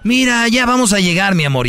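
exaggerated "look, we're almost there"; mock excited parent. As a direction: fake excitement to distract a crying kid. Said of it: "como si fuera un niño".

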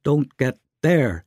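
shout. Acted. Nick shouting at Alina while chasing the bat, slow firm shout. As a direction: call it out over the noise. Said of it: "he shouted".

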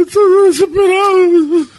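faked desperate wail. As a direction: cry it out like a desperate son. Prank caller fakes grief on phone. (lamento fingido, agudo y lento)

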